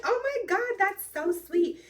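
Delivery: high-pitched, touched gushing; delighted gushing. Retold or imitated. Thanking a partner for a birthday cake, delighted gushing. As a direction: gush it high, stretching the sweetness out.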